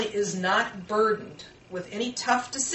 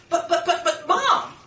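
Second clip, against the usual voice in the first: high, loud, laughing "but, but, but, Mom!" like a daughter; mock protest; 8 semitones higher, 6 dB louder than usual. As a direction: sputter a child's protest through a laugh.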